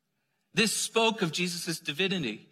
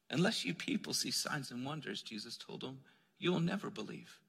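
much softer, gentle and patient, no edge at all; kind tone; 3 semitones lower, 10 dB quieter than usual. Retold or imitated. Jesus gently telling the royal official, kindly and softly. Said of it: "in the tone of kindness instead of harshness".

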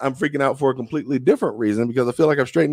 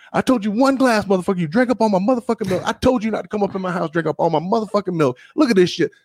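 high mock fury at the milk thief; outraged rant; 8 semitones higher than usual. Friend berating him over the drunk milk, high mock fury.

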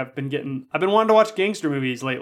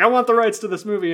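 higher, louder eager bidding; eager buyer; 6 semitones higher, 4 dB louder than usual. Retold or imitated. Buyer at the screening, keen to grab the rights, eagerly.

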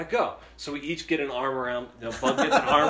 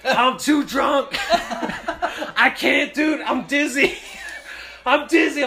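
put-on high, laughing drunk whine; drunk friend voice; 10 semitones higher, 6 dB louder, slower than usual. Drunk buddy dangling from a chute, high giggling whine. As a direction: play the dizzy drunk buddy, giggling and helpless.

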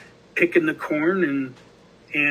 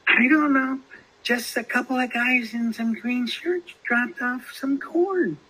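retold reply in a much higher voice; imitating the lady; 9 semitones higher than usual. Lady answering the guy about dropped-off corn, high imitated voice. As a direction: mimic her in a high-pitched put-on voice. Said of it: "and, and she goes".

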